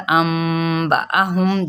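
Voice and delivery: انبأہم chanted very slowly, with iqlab; Quranic recitation. Shown as a demonstration. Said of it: "وہی میں جو میں نے ادھر یہاں پہ بتایا". (آہستہ تجوید کے ساتھ تلاوت)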